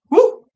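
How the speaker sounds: high loud whoop. Real, not acted. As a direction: let out a big cheer. Cheering alone.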